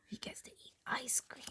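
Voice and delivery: hushed envious whisper. Real, not acted. Parent whispering to child about ice cream. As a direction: whisper it like a secret.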